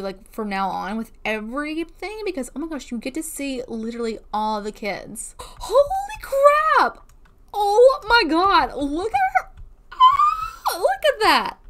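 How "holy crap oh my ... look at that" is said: high, loud delighted shrieks; excited squeal. Real, not acted. Gamer sees her sim's child has grown, squealing with delight.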